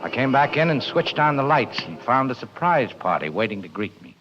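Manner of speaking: hard-boiled narration. A narrator's reading. Detective narrating the night he got the falcon, hard-boiled. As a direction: lay the story out like a tired private eye.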